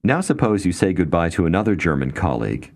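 ordinary talk, nothing marked

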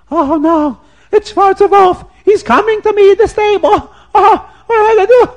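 high, loud panicked wail; scared Rav. Acted. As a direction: panic as the brute approaches. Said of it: "the Rav of Chensikov is so scared".

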